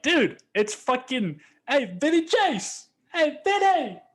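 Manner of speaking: high loud New York call of Turtle, drawn out; Turtle impression. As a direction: shout across the lot like Turtle spotting Vinny. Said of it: "yell that out like I'm fucking Turtle".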